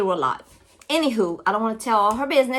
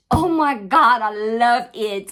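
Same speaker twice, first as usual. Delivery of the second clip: loud, delighted outburst; excited gushing; 6 dB louder than usual. Real, not acted. Vlogger thanking friend for bookmark, excited gushing. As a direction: burst out with real delight.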